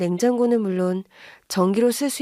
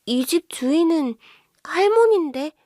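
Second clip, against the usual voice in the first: puzzled; 7 semitones higher than usual. Acted. Wife answers the child, puzzled.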